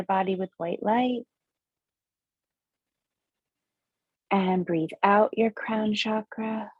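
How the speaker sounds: slow meditation guide, long pauses between breaths; guided breathing meditation. Acted. Host guides listeners through seven breaths, measured meditation voice.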